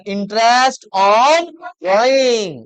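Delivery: Interest on drawing drawn out very slowly; slow announced term. Shown as a demonstration. Teacher stretches Interest on drawing slowly for the class. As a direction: spell out Interest on drawing word by word. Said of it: "उसको क्या कहा जाएगा?".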